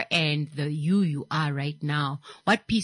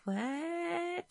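high, incredulous What; shocked disbelief; 9 semitones higher than usual. Retold or imitated. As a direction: play it up like you can't believe it. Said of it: "And I was like".

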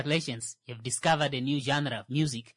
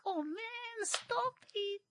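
exaggerated high-pitched whine, softer; high put-on voice; 8 dB quieter, much slower than usual. Shown as a demonstration. Mocking how friends say it, high silly voice. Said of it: "The first one makes me sound like a chomps".

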